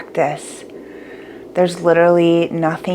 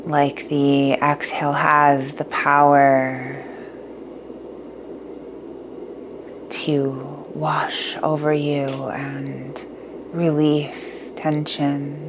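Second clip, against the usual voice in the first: guided meditation; 6 dB quieter than usual. Acted.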